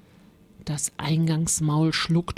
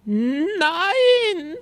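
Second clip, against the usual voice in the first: long, high wail; screamed refusal; 11 semitones higher, 4 dB louder than usual. A narrator's reading. Rosa about to be eaten, screaming in terror. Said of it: "schrie Rosa".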